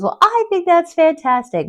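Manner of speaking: high, loud gushing voice; hammed-up loud approval; imitated salon customer. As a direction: gush like an enthusiastic stranger, pitched high. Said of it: "She goes".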